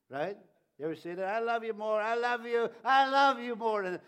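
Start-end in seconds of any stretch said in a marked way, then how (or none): 1.2-4.0 rising, louder gushing declaration; building excited "I love you more". Retold or imitated. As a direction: pour it out like a smitten lover.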